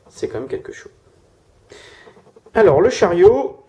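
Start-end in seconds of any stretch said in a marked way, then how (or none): 0.1-0.9 very soft, whispered repeat; whispered aside. Real, not acted. Tarot reader repeating a remark to themselves, whispered. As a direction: whisper it, barely any voice.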